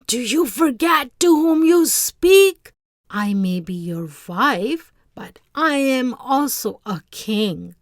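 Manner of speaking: wife raging at the fisherman, loud and imperious. Acted. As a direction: rage at the disobedient husband. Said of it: "His wife raged".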